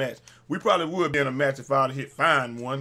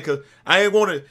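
normal talking voice

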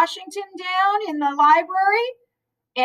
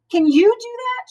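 playful challenge; 12 semitones higher, 3 dB louder than usual. A narrator's reading. Amelia Bedelia challenging the children.

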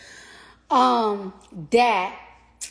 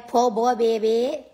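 put-on Nola accent; 3 dB louder, slower than usual. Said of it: "I can do a fucking Nola accent for shit".

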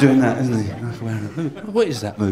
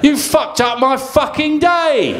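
high, slow raging outburst; stranded traveller losing it completely; furious rant; 6 semitones higher, slower than usual. Acted. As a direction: explode at the ruined trip. Ranting traveller in comic act.

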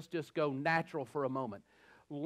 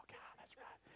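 whisper; 27 dB quieter than usual. Real, not acted.

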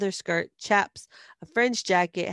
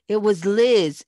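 loud, higher, with weight on "Liz"; insistent; 4 semitones higher, 9 dB louder, slower than usual. A narrator's reading. Boy insisting he knew who ran off, loud stress on "Liz". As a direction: insist on "Liz", hitting the name hard. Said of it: "insisted J T".

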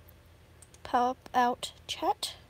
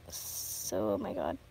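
low groaning; 7 dB quieter than usual. Real, not acted.

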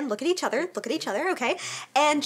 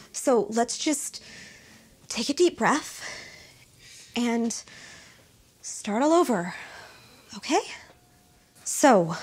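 calm soothing voice; 3 semitones lower, slower than usual. Acted. Therapist soothing, starting over.

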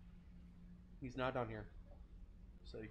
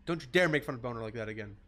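ordinary delivery

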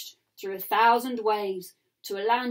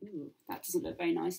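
usual speaking voice

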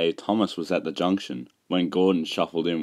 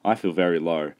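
plain reading voice

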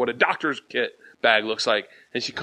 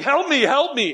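high mock plea; pitched-up mock help; mock cry for help; 10 semitones higher than usual. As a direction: whimper for help, hamming it. Tied up naked on stage.

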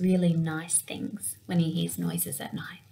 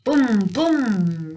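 loud booming thunder; loud mock thunder boom; 3 semitones higher, 7 dB louder than usual. A narrator's reading. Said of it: "rumbles thunder".

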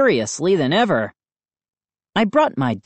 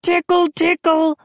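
very high squeaky tickling voice; 14 semitones higher than usual. A narrator's reading. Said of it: "Moose squeaked".

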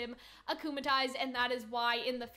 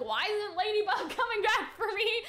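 smug villain whine; 8 semitones higher, 4 dB louder than usual. Retold or imitated. Cat Blanc bored on roof.

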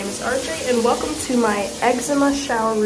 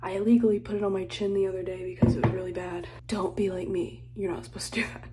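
soft conspiratorial whisper; 5 dB quieter than usual. Real, not acted. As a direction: hush it like a confession. Whispered skincare warning.

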